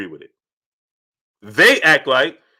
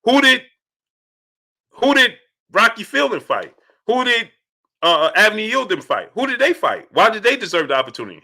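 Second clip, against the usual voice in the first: higher, pressing mock challenge, quick at the end; imitating Andrade's questions; 6 semitones higher than usual. Streamer voicing Andrade challenging Canelo, higher and pressing. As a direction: needle Canelo with rapid questions. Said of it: "He said he was asking Canelo".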